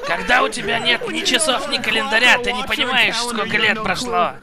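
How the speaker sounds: ordinary delivery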